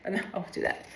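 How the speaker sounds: breathy whisper, half to herself; whispered aside. Real, not acted. Vlogger whispering a private aside to herself, whispered. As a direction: let it slip out as a private whisper.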